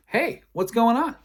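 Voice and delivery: high, loud, cheery hello; upbeat greeting. Acted. Bassist walks in and greets him, high and loud. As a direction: call it out high like a surprise hail.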